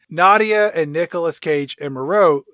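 ordinary delivery

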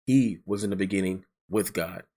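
"He" pushed out firmly at the start; stress on He. Shown as a demonstration. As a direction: stamp it, leaning on "He". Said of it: "he just stamped it again".

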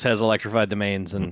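usual speaking voice

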